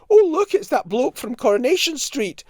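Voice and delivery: high, loud, badly faked Scottish accent; appalling Scottish accent. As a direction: imitate an excited Scottish woman with a hammed-up accent. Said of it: "She was Scottish".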